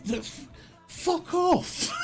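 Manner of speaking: outraged disbelief. Real, not acted. Comedian reacting to the old woman's fat insult, shrill disbelief. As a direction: squeal it out in shocked disbelief. Incredulous dismissal.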